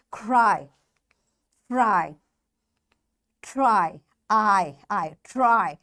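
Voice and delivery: "Cry, Fry, Try" as separate words with a held long I; long I sound. Shown as a demonstration. Teacher models one syllable Y words, long I held. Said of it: "Long I sound".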